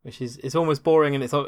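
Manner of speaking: ordinary talk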